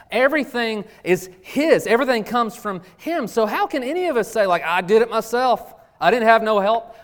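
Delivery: preaching. Acted. Pastor drives the point home to the church, preaching hard. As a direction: preach it out to the congregation with fire.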